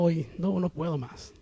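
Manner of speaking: low, soft, worn-out admission; understated exhausted sigh of words; retold weariness. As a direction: sag as if privately giving up. Voices his inner exhaustion.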